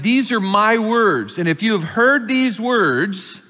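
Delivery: preacher reading Jesus' words; scripture reading. Acted. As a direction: declaim the parable to the congregation. Reads parable aloud.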